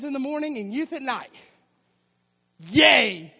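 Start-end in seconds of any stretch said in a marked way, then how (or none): 2.7-3.3 mock cheer. Sarcastic cheer about youth duty.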